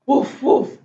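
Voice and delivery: short imitation woofs; quick, playful mock barking; puppy bark. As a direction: bark like a little dog. Story reader barks as puppy Biscuit.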